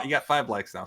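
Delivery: usual speaking voice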